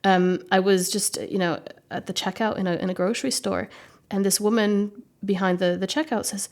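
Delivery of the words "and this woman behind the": ordinary talk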